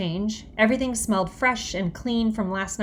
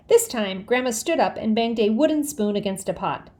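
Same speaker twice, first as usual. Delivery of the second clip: ordinary delivery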